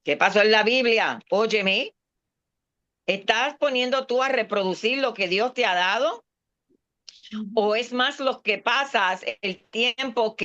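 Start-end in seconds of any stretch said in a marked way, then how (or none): none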